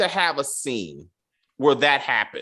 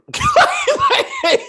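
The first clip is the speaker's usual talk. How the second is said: high burst of laughter; 7 dB louder than usual. Real, not acted. Cracks up at the scene.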